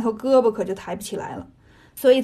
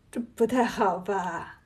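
slow, soft, lower doubtful murmur; stunned hesitation; 4 semitones lower, 7 dB quieter, slower than usual. A narrator's reading. Pig demon is invited to strike the head, stunned and hesitant. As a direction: trail out the doubt, taken aback. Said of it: "猪刚烈愣了".

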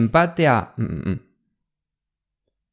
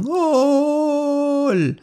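long drawn-out goal cry; 9 semitones higher than usual. Shown as a demonstration. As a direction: stretch the goal call out, high and slow. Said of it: "很长很长". (拉长的进球欢呼)

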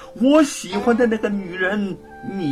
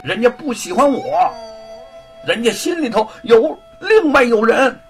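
gritting his teeth, louder, confessing; reluctant confession; 8 semitones higher, 6 dB louder than usual. A narrator's reading. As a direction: force the painful words out. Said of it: "终于咬咬牙说".

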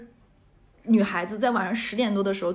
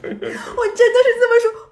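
laughing; 12 semitones higher, 11 dB louder, slower than usual. Real, not acted. Laughing at own story.

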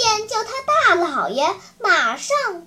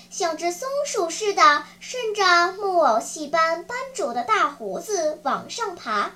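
usual speaking voice

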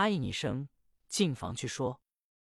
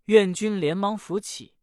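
ordinary delivery, nothing marked

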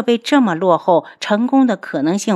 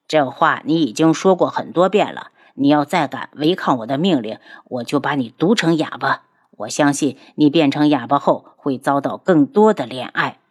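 lower, cold threatening voice; menacing ghost doctor; 4 semitones lower than usual. Acted. Ghost doctor threatens to poison the girl mute, low menace.

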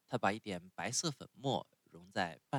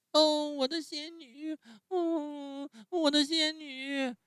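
much higher, louder, slow drawn-out wail; loud wailing call; 6 dB louder, slower than usual. A narrator's reading. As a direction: cry out desperately for the fairy. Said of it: "大声叫起来".

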